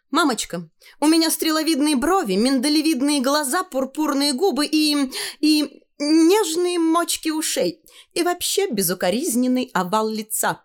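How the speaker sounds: put-on high, vain little-girl voice; high-pitched, showing off to mother; self-admiring girl. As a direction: preen in a high girlish voice. Tanya admires herself before mirror.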